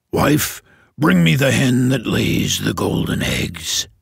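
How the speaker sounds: lightly voiced ogre giving orders. The ogre ordering his wife at breakfast, low.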